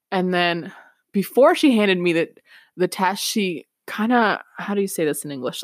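ordinary talk, nothing marked

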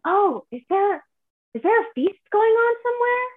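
high, loud, eager mock question; curious stranger. Imitates curious strangers asking.

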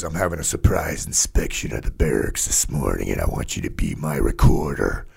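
low, stern sergeant major voice, imitated; gruff sergeant major. Sergeant major announcing a surprise inspection to a private, low and stern.

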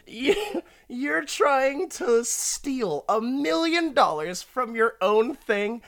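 high, slow disbelief; incredulous. Real, not acted. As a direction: stretch out the disbelief. Mocking the character's plan.

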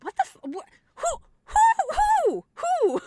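high, laughing who who; shocked disbelief. Retold or imitated. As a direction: squeal in stunned confusion. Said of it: "I was like".

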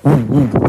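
short echoey oohs; wall's ringing noise. Retold or imitated. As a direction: imitate a reverberating wall with hoots. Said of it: "stop it making the".

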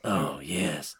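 a lightly voiced dark hero drawl, mocking; mock Geralt voice. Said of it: "He talks like this".

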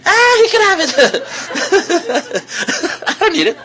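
high, laughing careless voice of the tourist; laughing shrug. Retold or imitated. A preacher voicing the tourist who laughs off his pointless purchase, high and laughing. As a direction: brush it off through a laugh.

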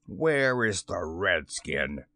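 low, slow pirate captain; ominous low question; demanding. A narrator's reading. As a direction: demand it in a deep, measured voice. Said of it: "he demanded s-, abruptly".

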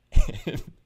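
sudden loud burst of laughter. Real, not acted. Cracking up loudly at his own story, loud laugh. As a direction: break into a big laugh at the punchline.

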